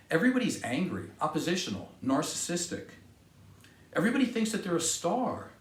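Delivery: usual speaking voice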